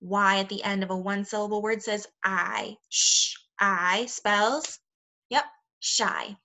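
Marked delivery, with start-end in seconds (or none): 2.9-4.1 "Sh" as a drawn-out hush, then a long "I"; sounded-out letters. Shown as a demonstration. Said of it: "So S H says sh".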